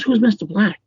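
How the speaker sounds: retold baffled question, pitched high; puzzled father. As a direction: imitate a parent asking in disbelief.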